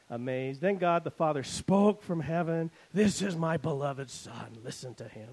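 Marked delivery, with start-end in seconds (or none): 2.9-5.3 only a hint of a booming God; understated heavenly voice. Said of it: "Very booming voice".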